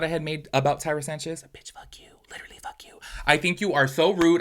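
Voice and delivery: harsh whispered insults; whispering the curses at Tyra. Real, not acted. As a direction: hiss the insults under the breath.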